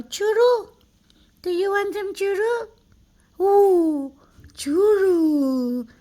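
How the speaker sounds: high and loud, all hyped up over the treat; excited treat offer. Acted. Owner tempting her cat with a churu treat, loud and excited. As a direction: sell the treat like it's the best thing ever.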